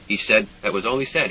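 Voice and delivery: plain reading voice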